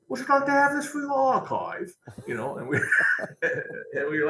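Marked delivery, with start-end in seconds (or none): 0.1-1.9 put-on much higher, English rock star accent. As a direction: impersonate a gracious English rocker. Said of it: "in that wonderful accent".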